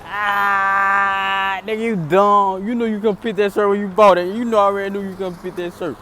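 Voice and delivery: high, loud mocking woman's voice; mocking female voice. Woman in the truck mocking him, high and loud. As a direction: tease him like the laughing woman. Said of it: "the female was like".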